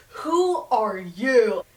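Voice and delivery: put-on slow, drawn-out suspicious kid voice; kid's demand. Son asking the stranger who he is, slow and wary. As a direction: stretch it out like a wary boy.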